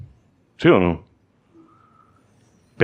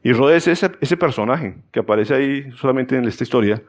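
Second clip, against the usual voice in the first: ordinary talk, nothing marked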